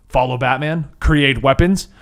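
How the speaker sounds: usual speaking voice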